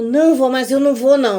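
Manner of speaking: protesting granddaughter. Retold or imitated. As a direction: hurry to deny it, a bit alarmed. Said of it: "Ela falou". (neta negando depressa, mais alto e agudo)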